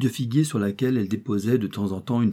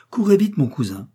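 eager urging; 6 semitones higher, 6 dB louder than usual. A narrator's reading. Urging cousin to open door.

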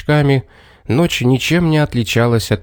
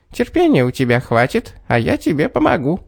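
little man voice; 4 semitones higher than usual. A narrator's reading.

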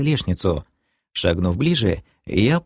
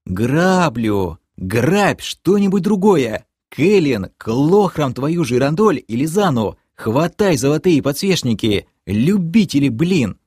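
exasperated orders; 6 semitones higher than usual. Acted. Frustrated leader yelling orders.

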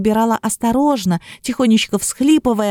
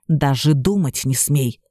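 lower, slow threatening hiss; menacing hiss; 7 semitones lower, slower than usual. A narrator's reading. Serpent warns the princess not to kiss, lower slow menacing hiss. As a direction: hiss it low and slow as a warning. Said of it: "угрожающе прошипел змей".

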